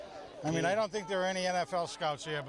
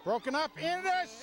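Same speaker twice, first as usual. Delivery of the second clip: excited; 8 semitones higher than usual. Real, not acted. Commentator reacts to an interception, excited. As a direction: voice jumps high on the interception.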